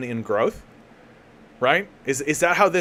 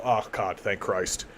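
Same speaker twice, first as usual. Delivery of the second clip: mass relief; 5 semitones lower, 8 dB quieter, slower than usual. Retold or imitated. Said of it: "everybody all at once simultaneously is gonna go".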